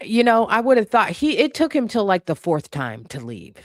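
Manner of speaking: ordinary talk, nothing marked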